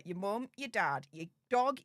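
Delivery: ordinary talk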